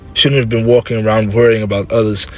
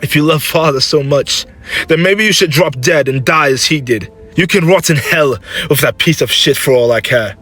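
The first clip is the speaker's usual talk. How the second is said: raised, bitter argument; heated lashing out; 5 semitones higher than usual. Acted. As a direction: lash out at mother to wound her. Son lashes out at mother.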